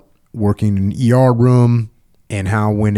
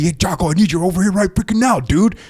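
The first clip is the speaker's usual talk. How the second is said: higher, impatient, urgent call for help; mock frustrated demand; 7 semitones higher than usual. Stressed teammate demanding Jocko come now, mock frustrated. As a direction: sound stressed and fed up. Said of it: "you're under pressure, you're frustrated".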